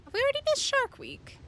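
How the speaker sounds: high squeaky mock panic; squeaky high question. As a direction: squeak it out like a cartoon. Repeats the question much higher.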